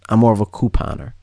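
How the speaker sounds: ordinary talk, nothing marked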